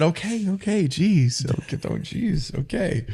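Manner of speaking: high, backing-off okays that fade to a breath; mock placating. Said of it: "I said".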